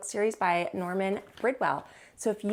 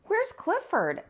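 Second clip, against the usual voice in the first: much higher wondering question; curious small voice; 11 semitones higher, much slower than usual. Acted. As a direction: ask it like a puzzled little dog. Cleo asking in story.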